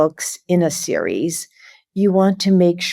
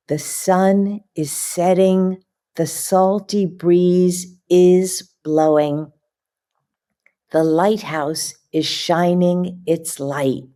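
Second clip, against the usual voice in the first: lively, slow picture-book reading with puppy noises; reading to children; 3 semitones higher, slower than usual. Acted. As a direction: read it like bedtime story time for little kids.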